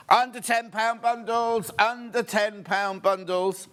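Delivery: lifted, drawn-out menu announcement; slow announcer call. Real, not acted. Presenter announcing the show's bundles, slow announcer style. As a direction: stretch it out like a headline.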